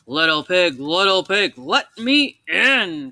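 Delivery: a hungry wolf growling at the door. Acted. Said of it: "growled the wolf".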